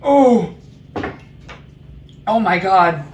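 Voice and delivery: loud startled oh; shocked gasp. Real, not acted. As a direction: react as heat hits. First taste of hot sauce.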